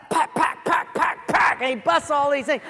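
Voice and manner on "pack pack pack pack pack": loud, percussive imitated cracks; rapid-fire popping noises; cord-snapping sound effect. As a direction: spit out each snap hard and loud.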